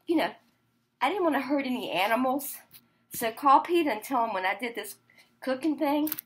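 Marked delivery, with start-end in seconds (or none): none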